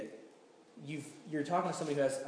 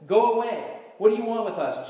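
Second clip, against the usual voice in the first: higher, louder demon's outcry; crying out loudly; 6 semitones higher, 7 dB louder than usual. A narrator's reading. Demon crying out at Jesus in the synagogue, loud outcry. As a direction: read it as a raised-voice cry of protest. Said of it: "He cried out at the top of his voice".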